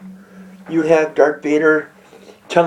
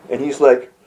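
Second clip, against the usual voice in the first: ordinary delivery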